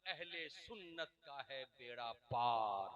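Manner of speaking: slow, soft, low couplet line; hushed verse recital. Shown as a demonstration. Preacher introduces the poet's verse, hushed recital. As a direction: recite it slowly, held well down. First, quiet take of verse.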